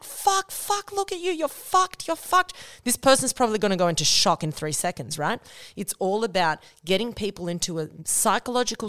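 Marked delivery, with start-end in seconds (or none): 0.0-2.5 high, frantic mock panic; shrill imitation of a freaking-out paramedic; panicked paramedic. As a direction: squeal it like a panicking rescuer. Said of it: "running to the scene being like".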